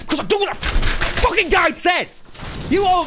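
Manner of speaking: high-pitched, drawn-out exasperation; angry, slow and high retort; tilted outburst. Real, not acted. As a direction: hammer each word at chat, fed up. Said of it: "you lads are pissing me off".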